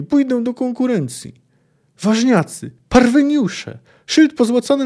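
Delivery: high, loud indignant yelling; thrown-out young man shouting back from a safe distance; shouting youth. Acted. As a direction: scream the insults at the bouncer. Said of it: "pokrzykiwał i gestykulował".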